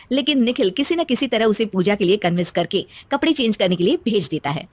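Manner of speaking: ordinary delivery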